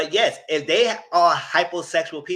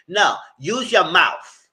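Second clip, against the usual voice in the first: firm demand; 4 semitones higher, 7 dB louder, much slower than usual. Real, not acted.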